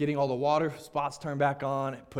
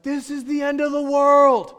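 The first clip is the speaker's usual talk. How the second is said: hammed-up despairing cry, much higher and louder, slow; overdramatic wail; 14 semitones higher, 15 dB louder, slower than usual. Pastor mocking his own meltdown, hammed-up wailing cry. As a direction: wail it like the world is ending. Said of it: "I get overdramatic when I'm tired".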